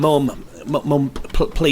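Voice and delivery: high-pitched, stammered plea; anxious, stumbling appeal; distressed pleading. Acted. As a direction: beg a parent to stop, breaking off mid-word. Son pleads with raging mother.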